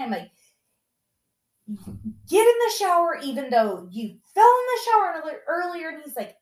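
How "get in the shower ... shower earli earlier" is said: bossy yell. Retold or imitated. Mom ordering son into shower.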